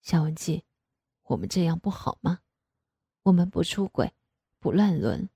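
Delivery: low, soft father soothing her; coaxing. A narrator's reading. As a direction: gently talk her round. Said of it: "哄着我说". (低声哄劝)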